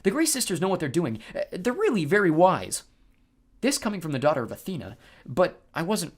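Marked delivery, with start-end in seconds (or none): 0.0-2.8 higher, slightly louder anxious voice; worried reassurance. A narrator's reading. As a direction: insist it's fine, sounding worried. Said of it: "sounding pretty worried".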